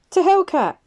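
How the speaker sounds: put-on high, loud drunken toast; rowdy toast. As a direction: raise a glass and bellow it. Carl toasting, high far voice.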